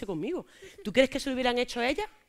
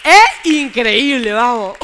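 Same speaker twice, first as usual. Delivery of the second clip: loud, slow, drawn-out disbelief; amazed exclamation; 2 semitones higher, 14 dB louder, much slower than usual. Real, not acted. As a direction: stretch it out in amazement. Amazed at what happened.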